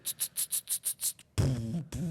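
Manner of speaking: whispered tut-tut drum beat; beatbox drums. Shown as a demonstration. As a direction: mouth the drum pattern. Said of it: "du drum qui fait comme". (imitation de batterie à la bouche)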